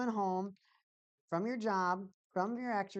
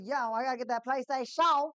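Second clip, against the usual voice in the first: higher, louder exclaimed answer; acted reply; 7 semitones higher, 7 dB louder than usual. Streamer answering the imagined viewer, raised voice. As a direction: call it back, raised and emphatic. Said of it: "I'm like".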